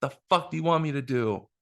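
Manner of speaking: mild mock yell, pitched high; retold angry yell. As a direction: imitate an exasperated mother's outburst without full volume. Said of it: "she yells at me. She's pissed".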